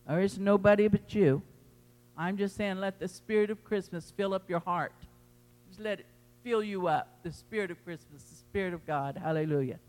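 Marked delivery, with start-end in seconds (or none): 2.2-9.8 much softer, hushed and tender; softened blessing. Acted. Pastor blessing the church, softly and tenderly. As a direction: let it settle gently over them.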